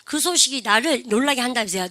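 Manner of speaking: ordinary talk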